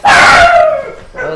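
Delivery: sudden high yelp of surprise; a startled cry. Real, not acted. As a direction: jump at the dog's noise.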